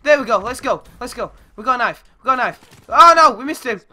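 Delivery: ordinary talk, nothing marked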